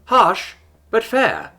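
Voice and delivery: a stoner android, totally relaxed but brash; bold chill bro. A narrator's reading.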